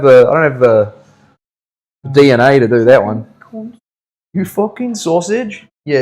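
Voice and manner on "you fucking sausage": higher, slow Scouse insult; a stab at a Scouser's voice after failing; attempted Scouse accent. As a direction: try the Liverpool accent, drawn out. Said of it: "I can't do it".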